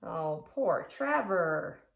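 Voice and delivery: normal talking voice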